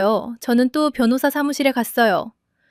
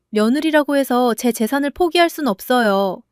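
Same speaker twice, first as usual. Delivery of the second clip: ordinary delivery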